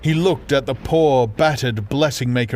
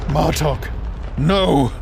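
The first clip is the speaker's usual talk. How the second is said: a brother crying out in despair; 5 semitones higher, slower than usual. Acted. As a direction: cry out as she dives away. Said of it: "he cried out".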